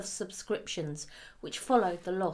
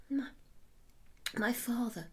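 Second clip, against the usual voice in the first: stammering; 3 semitones higher, 9 dB quieter than usual. Acted. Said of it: "she stammered".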